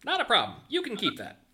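high, loud character voice; Buddy, obliging. Acted. Buddy lets player keep the pen, high obliging voice. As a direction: wave it off in a high, emphatic voice.

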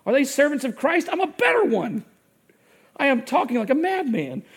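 building heated outburst; riled-up Paul. Retold or imitated. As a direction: read Paul's boast like his blood is boiling. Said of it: "riled up, his blood boiling".

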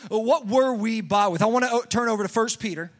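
usual speaking voice

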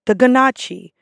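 ganache said as guh-NAH-chee; mispronounced gnocchi. Retold or imitated. Server quoting guests, mispronounced "ganache" as guh-NAH-chee. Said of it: "that mispronounce this word".

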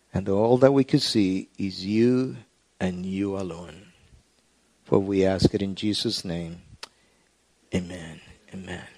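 soft, hushed pastoral prayer; murmured prayer over the congregation; quiet prayer. Acted. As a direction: pray it low and intimate before God. Pastor prays before the sermon.

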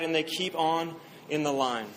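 normal talking voice

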